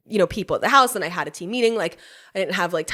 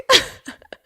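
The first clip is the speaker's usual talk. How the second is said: high burst of laughter; 8 semitones higher, 4 dB louder than usual. Real, not acted. Laugh after realization.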